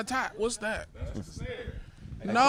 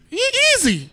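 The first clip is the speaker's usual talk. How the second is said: mock alarmed yelp; 11 semitones higher, 5 dB louder, faster than usual. Reacting to weed money joke.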